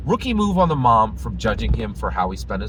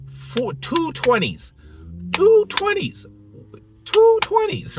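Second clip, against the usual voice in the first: high, drawn-out amazement; incredulous emphasis; 4 dB louder, slower than usual. Retold or imitated. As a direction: stretch it out high and punchy, in disbelief. Showing amazement at kid's cash.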